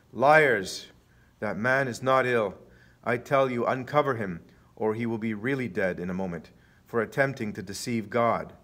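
loud accusation, easing into a stern warning; thundering shout. A narrator's reading. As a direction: cry out the first word, then denounce. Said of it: "opens his arms and shouts".

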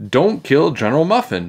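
high-pitched protest; pleading shout. A narrator's reading. Miss Krupp to the boys, pleading shout. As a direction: cry out to stop them. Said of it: "shouted Miss Krupp".

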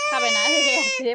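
loud high coaxing. Real, not acted. Mother loudly teases her sulking daughter. Crying child refuses.

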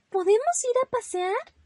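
very high, hopeful little voice; eager child rabbit. Acted. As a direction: ask mother with bubbling hope. Young rabbit asking mother eagerly.

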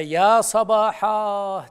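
long, slow ringing shout of alarm; alarm cry. Retold or imitated. Prophet calls people from Safa hill, loud and slow alarm cry. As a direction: call it out like a warning from a hilltop. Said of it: "diye bağırdı".

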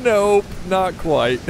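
high, long drawn-out mock refusal; voicing the waves. Player speaks for waves stopping short of shore, playful drawn-out voice. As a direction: stretch the first word way out, playfully high. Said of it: "and they're like".

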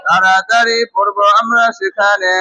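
slow, measured classroom recitation; rule recitation. Shown as a demonstration. Teacher leading a class through a reading rule, slow recitation. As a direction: chant the rule out slowly, word by word.

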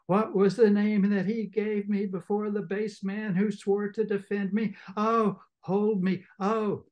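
hammed-up fainting hysteria; hysterical sister. Sister begging to be held in mock distress.

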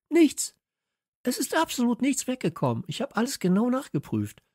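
put-on very high, urgent boy's voice; high-pitched, worked-up character voice; agitated insistence. A narrator's reading. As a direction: insist excitedly, pitched right up. Kalle agitated about the uncanny break-in.

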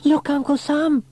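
put-on high, loud, excited child's voice. A narrator's reading. James arriving to show his uncle, excited. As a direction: burst out with news as a small boy. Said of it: "said James excitedly".

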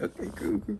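high, airy okay cool cool; relieved squeak while faking sleep; mock relieved. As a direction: squeak it out like you got away with it.

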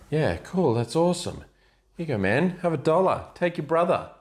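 high, chirpy fake friendliness; mock cheery brush-off. To a stranger, a high breezy fake-friendly brush-off. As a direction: toss it off breezily, all bright and upbeat.

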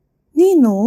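sudden high cry of shock; startled exclamation. A narrator's reading. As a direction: blurt it out in surprise. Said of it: "อลิซาเบธอุทาน".

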